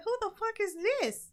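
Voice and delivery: high-pitched incredulous question; mock disbelief. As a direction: ask it high, as if baffled. Retells disbelief at shabby museum pictures.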